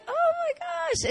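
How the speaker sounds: very high, drawn-out amazement; excited squealed gasp. Retold or imitated. Cousin retells the reunion, high excited mock squeal. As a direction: squeak it out slowly in delight. Acts her reaction to finding cousin.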